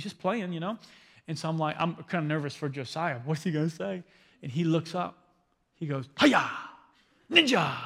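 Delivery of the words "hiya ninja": mock karate cry, a bit louder and higher; playful ninja yell. Preacher imitating his small son's ninja yell at the neighbour. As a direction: shout it like a kid doing a ninja move.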